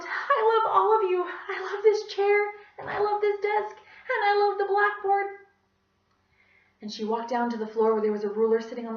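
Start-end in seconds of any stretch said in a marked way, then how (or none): 0.0-5.3 high, loud and slow with emotion; love everything in sight; overwrought gushing. A narrator's reading. Teacher tearfully declaring love for the classroom, high and loud.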